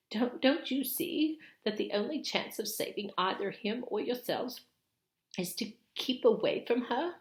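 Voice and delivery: high, loud, aghast stammer; horrified at the very idea; alarmed Missus Beaver. Acted. Missus Beaver aghast at the children's plan, loud and high.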